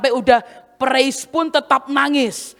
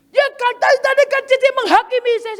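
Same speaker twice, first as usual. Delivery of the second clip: high, tearful-sounding mock tattling; hammed-up complaining girl; whiny complaint; 11 semitones higher, faster than usual. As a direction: imitate her running to the boss. Dancer insists she was judged.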